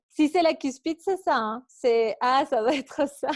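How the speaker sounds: on the edge of laughter; amused realisation. Real, not acted. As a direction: burst out as it dawns on you, amused.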